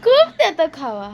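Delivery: high, loud, mocking glee; gleeful teasing. Real, not acted. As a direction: crow over the prank, laughing at her. Teasing mom after prank.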